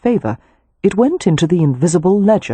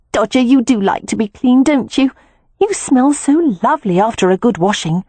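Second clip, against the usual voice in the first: higher, sweet voice for the ferret; cooing to a pet; 7 semitones higher than usual. A narrator's reading. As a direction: talk to a beloved ferret like a baby. Beatrix coos to her ferret.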